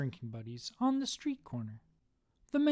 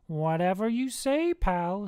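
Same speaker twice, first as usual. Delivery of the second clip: higher, mocking brush-off; dismissive Satan; 5 semitones higher, 4 dB louder than usual. Satan scoffs at the second man, dismissive. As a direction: humour him with a rising lilt.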